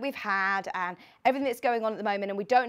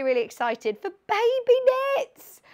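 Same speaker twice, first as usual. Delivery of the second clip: rising high and louder with real excitement; excited; 12 semitones higher, 4 dB louder than usual. Real, not acted. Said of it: "So I'm really".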